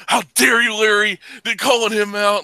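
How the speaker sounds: high, loud mock scolding; hammed-up indignant call-out; a mock pearl-clutching scold; mock outrage. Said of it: "it's like".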